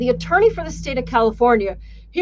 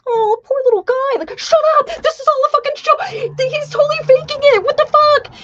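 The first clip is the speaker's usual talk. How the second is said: scornful jury; 5 dB louder than usual. Retold or imitated. Jury mocking the young lawyer.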